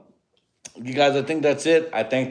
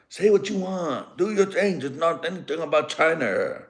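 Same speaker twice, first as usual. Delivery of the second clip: put-on old Japanese accent; 7 semitones higher than usual. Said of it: "I'm gonna give him an old Japanese accent".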